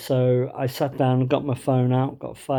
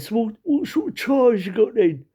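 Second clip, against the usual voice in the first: higher-pitched Cockney customer; 9 semitones higher than usual. Retold or imitated. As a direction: ask like an East End wide boy. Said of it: "So Cockney said".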